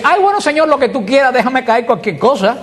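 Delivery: high-pitched, half-laughing whiny beggar imitation; hammed-up wishy-washy prayer, pitched high; mock plea. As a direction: play a vague beggar pleading for anything. Mock plea of a vague Bartimeo.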